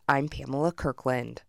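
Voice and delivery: usual speaking voice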